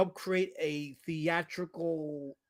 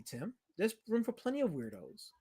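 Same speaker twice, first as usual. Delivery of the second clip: ordinary talk, nothing marked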